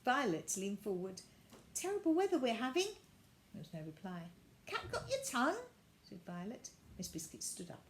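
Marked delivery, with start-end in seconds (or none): 1.7-2.9 put-on high, fast little girl; mock polite chat. Violet making small talk with the doll, high and fast. 4.6-5.6 put-on much higher cheeky child; teasing girl. Violet teasing the silent doll, high.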